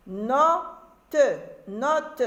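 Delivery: "note" as two syllables, no and te. Shown as a demonstration. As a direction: sound out the final e of "note". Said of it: "la lecture des mots".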